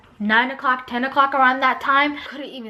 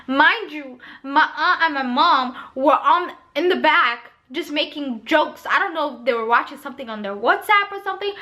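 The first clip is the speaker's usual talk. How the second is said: ordinary talk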